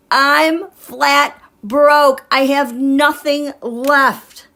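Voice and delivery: mock-dramatic lament. Voicing a card figure who lost everything, slow mock lament. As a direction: wail it like a ruined tycoon. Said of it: "Here's Putz".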